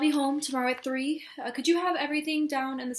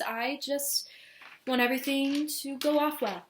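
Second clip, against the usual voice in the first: usual speaking voice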